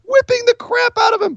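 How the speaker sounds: high, yelled exclamation; excited shout. Real, not acted. Wrestling fan yelling at the whipping, excited shout. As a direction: shout it out in glee.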